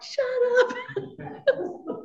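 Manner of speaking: high, sudden "shut up" with a laugh; snappy mock retort. Daughter snaps at mother's friend, high and laughing. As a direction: bite back at the fussing friend, half laughing. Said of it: "And I'm like".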